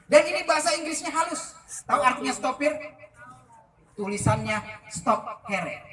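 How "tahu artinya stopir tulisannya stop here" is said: ordinary talk, nothing marked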